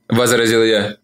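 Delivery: plain reading voice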